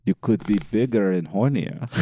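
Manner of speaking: low, hushed put-on voice; ghostly watcher, imitated. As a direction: play the unseen lurker commenting, low. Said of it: "And he's just like".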